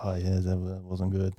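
soft, understated doctor impression; low-toned Irish surgeon. Man answers dazed patient, understated low-toned imitation. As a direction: lightly mimic someone breaking bad news quietly. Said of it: "had a really low tone of voice".

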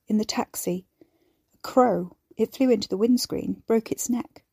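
ordinary delivery, nothing marked

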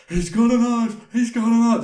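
high, loud, panicky cry; panicked repeating. Retold or imitated. To the police, high panicked blurting. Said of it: "That's all I could say".

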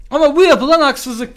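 high, loud staged outrage; fake anger. Student to principal, theatrically angry. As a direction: play up the indignation. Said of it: "fake sinirleniş".

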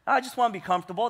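rushed, chuckling imitation of the phrase; laughing, fast mock quote. Pastor mocking churchgoers' excuse, laughing and fast. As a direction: toss it off quickly through a laugh. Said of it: "That's exactly what".

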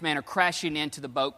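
usual speaking voice

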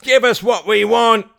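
high, loud mob demand; crying out. Acted. Crowd demanding the wealth, high loud cry. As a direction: shout it as a greedy crowd. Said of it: "cried the crowd".

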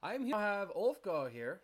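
loud, high showman's welcome; horror host. Acted. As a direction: boom it out like a spooky show host. In-character show host greeting.

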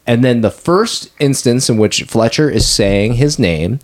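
ordinary talk

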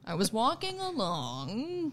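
slow, swooping rise on "along"; exaggerated slow upspeak; upward inflection. Shown as a demonstration. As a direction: stretch "along" up into a question. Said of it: "they upward inflection at the end".

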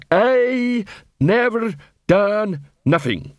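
slow, dull troll voice. A narrator's reading. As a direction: drag it out like a sullen troll. Troll repeats.

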